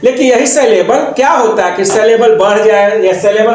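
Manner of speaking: ordinary talk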